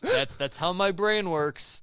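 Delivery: loud, slow mock pride; mock proud. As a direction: boast like a brainwashed fan. Mocking own programming.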